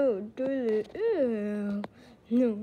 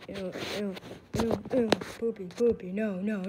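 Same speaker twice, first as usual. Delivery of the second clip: ordinary talk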